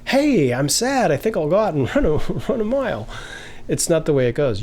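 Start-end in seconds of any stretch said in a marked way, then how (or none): 0.1-3.1 higher, laughing through a silly sad line; mock cheery sad. Teacher mocking a sad person who wants to go running, higher with a laugh. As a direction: ham up a sad person who bounces off jogging. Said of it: "You don't go like".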